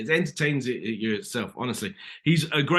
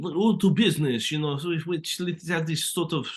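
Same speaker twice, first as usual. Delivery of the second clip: higher-pitched, put-on Flemish accent; comical Flemish accent; 6 semitones higher than usual. Shown as a demonstration. Actor showing host the accent producers wanted, comical Flemish accent. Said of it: "they want the accent to be".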